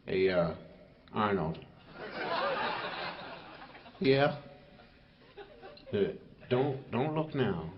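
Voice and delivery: a lazy tiger whispering a warning to his pal; low tiger voice. Acted. One tiger talks to another.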